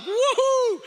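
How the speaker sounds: high laughing woohoo; delighted schoolboy cheer; excited whoop. Retold or imitated. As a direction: whoop with glee. Excited cheer in childhood story.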